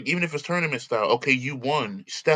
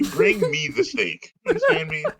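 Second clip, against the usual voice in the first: mock demanding guest; 9 semitones higher than usual.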